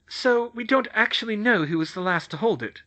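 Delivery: a hint of a brisk schoolgirl; Hermione. Narrator voices Hermione.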